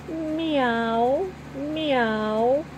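high rising meows like a small kitten. A narrator's reading. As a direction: mew like a hungry kitten. Kitten meowing in the car.

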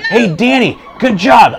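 high, loud sideline call; proud dad calling to his son. Retold or imitated. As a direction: shout praise across the court. Dad cheering son at game.

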